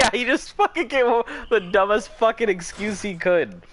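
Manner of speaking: high, loud words through laughter; laughing. Real, not acted. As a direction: keep laughing as you talk. Laughing at the story.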